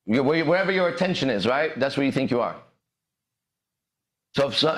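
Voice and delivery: ordinary talk, nothing marked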